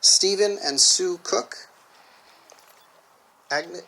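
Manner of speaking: loud, ceremonial officiant address; formal speech. Acted. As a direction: project it to the whole hall.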